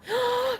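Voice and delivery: high, sudden gasp of excitement; excited gasp. Real, not acted. Crafter spots a hummingbird, high excited gasp. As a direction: gasp sharply at a thrilling sight. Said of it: "I was excited".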